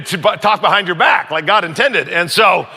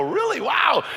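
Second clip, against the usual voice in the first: high fake amazement; mock surprise; 8 semitones higher, 4 dB quieter, slower than usual. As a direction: play innocent, overly amazed. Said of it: "I go".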